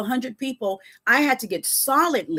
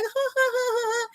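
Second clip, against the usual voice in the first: much higher, soft mock giggle; imitated customer laugh; 13 semitones higher, 4 dB quieter than usual. Customer charmed by the lemonade line, high mock giggle. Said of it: "they'd be like".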